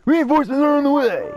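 high, loud copy of the announcer; imitating game voice line. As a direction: echo the game line, hammed up. Player mimics the game line.

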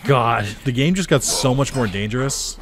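exasperated. Real, not acted. Game got more dangerous.

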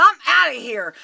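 loud, high-pitched yelling; indignant, explosive shouting; furious outburst. Retold or imitated. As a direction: tear into him at the top of your voice. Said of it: "I just blew up".

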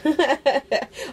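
real high, bubbling laugh; amused laugh. Real, not acted. Friend amused at how late the birthday package is, amused laugh.

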